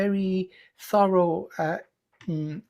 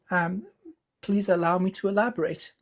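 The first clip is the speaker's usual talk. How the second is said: normal talking voice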